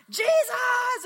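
very loud, high rallying yell; victory shout. Retold or imitated. Leader charging with the line of ministers, victory shout. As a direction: bellow it out as you charge. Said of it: "give a shout of victory".

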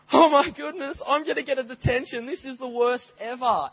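put-on high, panicky child voice; young boy panicking over a detention; panicked kid. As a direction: squeal it like a scared kid about to get detention. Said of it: "And I was like".

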